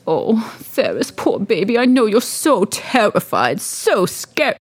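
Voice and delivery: cooing over a poor pet; doting mistress. Acted. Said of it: "she comes down and she says".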